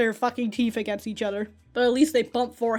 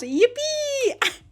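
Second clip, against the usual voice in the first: very high imitated cheer; gleeful yell; 13 semitones higher, 3 dB louder than usual. Imitating a girl yelling to the class, gleeful. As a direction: squeal it out in mock delight. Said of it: "she yells out to the class".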